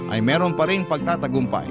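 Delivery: normal talking voice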